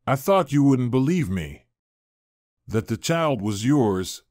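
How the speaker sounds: usual speaking voice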